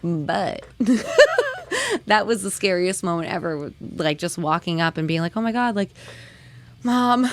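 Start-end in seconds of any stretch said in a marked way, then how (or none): 0.7-2.0 real laugh, very high; high squealing laugh. Real, not acted. Laughing hard. 5.3-7.3 higher, rushed imitation of her scared younger self; telling mom about the fight, in mock panic.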